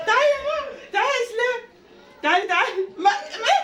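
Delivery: real excited call, pitched high. Real, not acted. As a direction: call the little one over, thrilled. Calling the little girl over.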